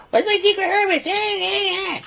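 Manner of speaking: very high, mocking falsetto Chelsea impression. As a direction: squeak it out like you're mocking her. Mocking Chelsea Green in rant.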